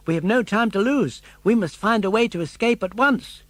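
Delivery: put-on eager puppet boy. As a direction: push him to hurry, a boy's eager voice. Pinocchio urging escape.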